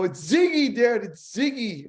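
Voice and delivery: put-on kids racing to tell dad who's on the phone; excited kids. Kids announce caller excitedly.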